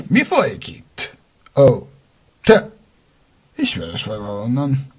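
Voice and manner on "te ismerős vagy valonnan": normal talking voice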